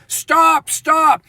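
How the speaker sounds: cops screaming. Retold or imitated. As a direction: bark it like a panicked cop. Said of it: "the cops screaming at them".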